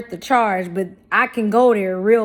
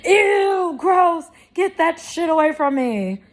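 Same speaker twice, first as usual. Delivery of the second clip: much higher, disgusted put-on voice; Grinch-like mom; 8 semitones higher than usual. Mimicking her mom recoiling like the Grinch, high and disgusted. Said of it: "she's like the fucking Grinch".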